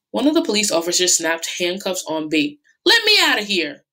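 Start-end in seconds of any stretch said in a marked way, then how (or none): 2.9-3.8 high, loud angry snarl. Acted. Bate in handcuffs, snarling at the police. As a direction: snarl it at the officers. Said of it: "Bate snarled".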